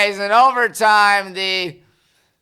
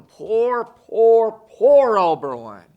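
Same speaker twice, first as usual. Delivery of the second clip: poor rising louder in mock sorrow; hammed-up pity for the losers; drawn-out poor, poor, poor, swelling in fake grief; 4 semitones higher, 4 dB louder, slower than usual. Mock pity for losing team.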